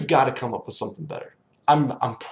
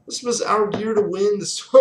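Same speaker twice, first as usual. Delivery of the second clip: excited outburst; 9 semitones higher than usual. Real, not acted. Fan rant about the season.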